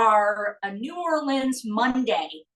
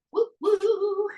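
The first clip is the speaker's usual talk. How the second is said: playful woo; 9 semitones higher than usual. Real, not acted. Can popped into the pan.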